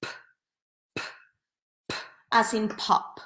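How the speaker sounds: p as a short puff, then pop; p sound demo. Shown as a demonstration. Teacher modeling the p sound for students. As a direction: pop out a crisp p each time. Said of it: "put your hands like this and go p".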